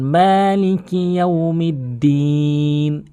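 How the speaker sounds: slow recitation with long sustained vowels; Quran recitation. Shown as a demonstration. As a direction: recite the verse, drawing out each long vowel. Demonstrates the verse in recitation. (ধীরে টেনে তেলাওয়াত)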